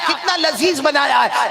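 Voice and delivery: high, slow admiring exclamation; gushing food praise. Acted. Husband eats again and praises the cooking, gushing. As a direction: relish each word of the compliment.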